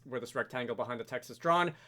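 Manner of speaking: usual speaking voice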